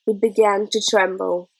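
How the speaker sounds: plain reading voice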